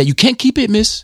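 high mock schoolboy protest; mimicked school troublemaker. Retelling a schoolboy arguing over his hat, high put-on voice. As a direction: imitate a kid objecting, pitch way up. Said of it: "And they're like".

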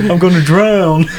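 teasing imitation, cracking up; imitating Daddy's complaint. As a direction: laugh through a mimicked cry of drowning. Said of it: "That's what he kept saying".

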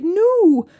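high, fast pleading; mock desperate plea. Racer begging the truck guy to fix her bike, desperate plea. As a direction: beg like it's life or death.

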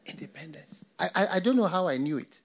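ordinary delivery

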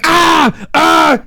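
loud high acted screams; painful screaming. Friends bludgeoned by him, painful high screams. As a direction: cry out as if struck. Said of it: "screaming, painful".

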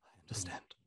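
a hushed whisper of acceptance. Acted. Whispering to the grieving elf, hushed. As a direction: barely breathe the words. Character quietly accepts being dismissed.

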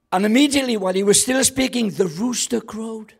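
fast, high, loud reading; dramatic scripture reading. Acted. Preacher reading Luke aloud, fast and high. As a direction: race through the verse.

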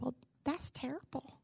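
put-on hushed, near-tearful whisper of a hurt child; a child's soft, wounded murmur. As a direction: barely get it out, about to cry. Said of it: "And I just started crying".

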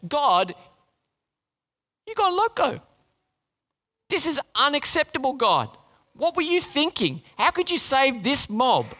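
hammed-up outraged prophet, squeaky high on loco; indignant whiny complaint to God; mock angry Jonah. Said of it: "he became angry".

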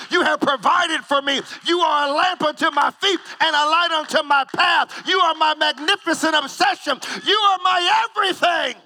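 building from plain to a high shouted cry; passionate praise. Pretend. Worshipper crying out praise to God, building to a shout. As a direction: pour everything into the praise, climbing to a shout. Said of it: "We'll be like".

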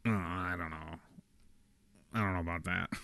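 lightly voiced unsure father brushing it off; dad voice impression. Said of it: "dad voice impression".

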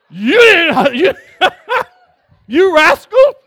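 loud laughing rebuke, you rascal; God laughing at a rascal; laughing scold. Acted. Preacher voicing God scolding, laughing.

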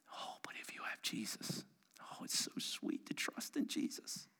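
very soft breathy whisper; whispered hymn line. Real, not acted. Preacher softly comforting the congregation with a hymn, whispered. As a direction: hush it like a secret comfort. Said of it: "whispered hymn line".

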